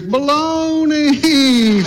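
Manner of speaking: high, drawn-out echoing call. Retold or imitated. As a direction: let it ring back like a distant echo. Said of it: "he hears".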